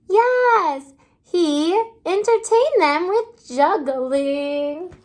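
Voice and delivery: usual speaking voice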